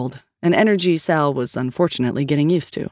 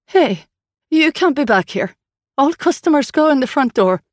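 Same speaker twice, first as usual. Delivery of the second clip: loud and much higher, shooing strangers away; calling out; 9 semitones higher, 4 dB louder than usual. Acted. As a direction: bark it down the alley at them. Waitress calls out to intruders.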